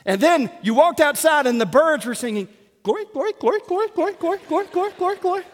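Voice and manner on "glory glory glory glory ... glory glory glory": high, quick bird-like chirps; chirping bird. Acted. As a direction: chirp it repeatedly like a tiny songbird. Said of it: "That's my impersonation of a bird chirping".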